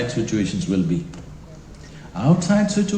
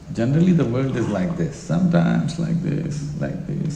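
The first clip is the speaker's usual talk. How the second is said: ordinary talk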